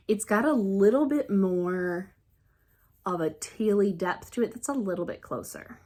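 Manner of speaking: ordinary talk, nothing marked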